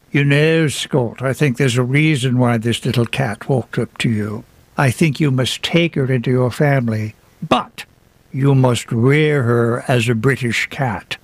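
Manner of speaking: put-on British embassy officer's accent; quoting her in an imitated British accent. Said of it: "forgive the accent, please".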